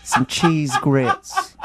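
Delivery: lightly hammed Southern belle accent, slow and sweet; mock Southern belle accent, laughing. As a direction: play a coy Southern belle drawling her reply. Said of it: "really cute Southern accent".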